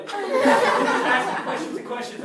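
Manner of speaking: high, loud and slow, laughing as he speaks; can barely get it out for laughing; laughing through words. Real, not acted. Telling the audience about the driver's endless questions, laughing.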